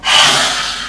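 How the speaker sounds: long open-mouthed breathy exhale; lion's breath exhale. Shown as a demonstration. Yoga teacher shows class the lion's breath, a forceful breathy exhale. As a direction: breathe out hard through the mouth like a lion.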